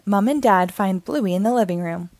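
plain reading voice

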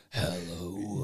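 creepy voice. Retold or imitated. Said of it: "super creepy voice".

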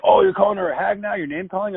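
high mocking outrage; imitated indignant men. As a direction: mimic their affronted objection. Men's indignant reply, acted.

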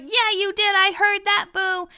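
very high, put-on teasing voice; [name]'s playful accusing. Cast member catching a booer, playfully accusing in a high voice. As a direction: crow it up high, stretching the end. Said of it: "she's having fun with everybody".

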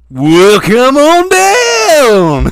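mock drawn-out pitchman. Imitating the dealer greeting a customer, drawn-out pitch. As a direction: stretch it out like a cheesy car dealer. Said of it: "talks like that normally".

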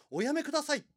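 high, loud cry to stop him; desperate cry. Acted. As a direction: shriek it as she grabs his arm. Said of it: "泣いて止めるんです".

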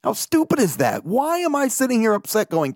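high-pitched, exasperated self-quote; mock-exasperated, high-pitched protest; retold mad outburst. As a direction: replay being upset, pitch jumping way up. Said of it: "Like, I was mad".

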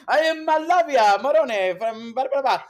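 loud, high hammed-up Moldavian accent; mock foreign accent. Comic mocking the name as a pseudonym, put-on foreign accent. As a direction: proclaim a fake name in a grand foreign accent. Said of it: "It sounds like some of my pseudonym".

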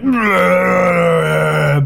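long, loud undead roar; zombie groan. Acted. Zombie run through by a sword, groaning. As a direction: let out a drawn-out zombie wail. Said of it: "the zombie is like".

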